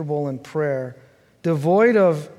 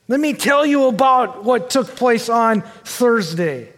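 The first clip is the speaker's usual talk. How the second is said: an excited churchgoer, raised and louder; voiced congregant; 6 semitones higher, 4 dB louder than usual. Preacher voices a church member bragging about a hard week, excited and loud. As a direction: play a worn-out member eager to tell his week.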